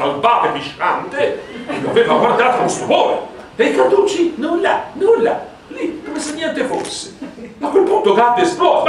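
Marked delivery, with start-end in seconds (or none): none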